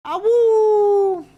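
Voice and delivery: high loud wolf howl. Pretend. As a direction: howl at the moon. Streamer howls like a wolf.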